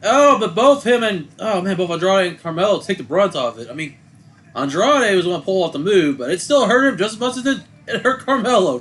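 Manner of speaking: loud excited reaction. Real, not acted. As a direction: shout it out, amazed at the move. Excited reaction to wrestling move.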